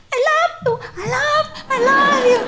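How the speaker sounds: very high, squeaky little dog voice; dog's voice. Retold or imitated. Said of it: "four or five times the words".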